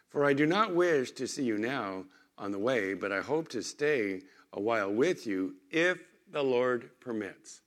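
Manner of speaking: solemn Bible reading to the congregation; reading scripture from the pulpit. Acted. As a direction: read the verse out with weight. Reads scripture verse aloud.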